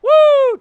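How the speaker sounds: high, loud celebratory woo; victory whoop. Real, not acted. Gamer whooping high and loud after winning the game. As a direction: let out a winner's whoop.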